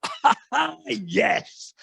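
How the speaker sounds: high gleeful cackle of a busybody; eager nosy glee; gleeful laugh. Retold or imitated. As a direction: laugh like someone delighted to wade in. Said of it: "some people see that and go".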